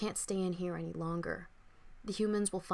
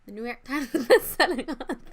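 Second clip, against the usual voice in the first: laughing; 5 semitones higher, 10 dB louder than usual. Real, not acted. Joking self-intro with laugh.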